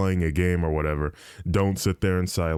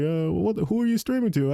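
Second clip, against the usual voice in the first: high-pitched imitation of a puzzled dad; questioning dad; 11 semitones higher than usual. Dad asking who his son talks to, high imitated questioning. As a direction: put on dad's voice, pitched well up. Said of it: "he's like".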